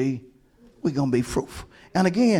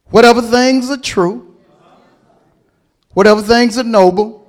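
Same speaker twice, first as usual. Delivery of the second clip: emphatic preaching litany; scripture reading; 5 dB louder than usual. Acted. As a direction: declaim each line with weight. Reading scripture list with force.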